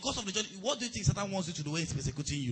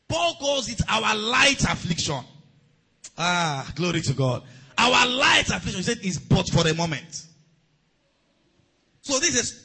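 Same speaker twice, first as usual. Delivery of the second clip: emphatic preaching; 5 dB louder than usual. Acted. Preacher stressing light affliction to the congregation, loud. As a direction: hammer each phrase home.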